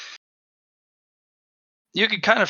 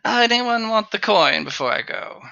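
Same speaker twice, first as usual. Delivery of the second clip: merchant voice; 7 semitones higher than usual. Acted. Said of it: "it's a little paranoid, that one".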